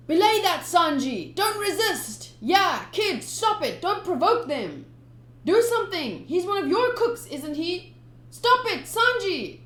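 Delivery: high, loud frantic shouting; panicked cooks. Acted. Ship cooks begging Sanji to stop, loud high shouting. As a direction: cooks yelling to stop the kid.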